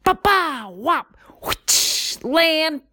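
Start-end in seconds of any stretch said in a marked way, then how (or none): 0.0-0.7 bump sound effect. Retold or imitated. Hitting the jump. 1.4-2.2 breathy whoosh with no voice. Retold or imitated. Truck flying sound effect.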